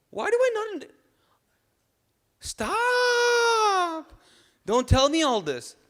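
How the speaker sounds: shrieking high-pitched cry of frustration; anguished yell. Acted. Exasperated outburst at self-hatred.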